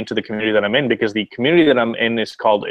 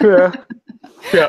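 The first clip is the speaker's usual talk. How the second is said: mid-yawn, words stretched open; 9 semitones higher, 6 dB louder than usual. Real, not acted. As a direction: say it through a yawn. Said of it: "Sorry, I'm yawning".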